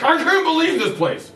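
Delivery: high-pitched acted rant; exasperated retold outburst; angry yell. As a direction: yell it at an employee, hammed up. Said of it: "I just yell at him".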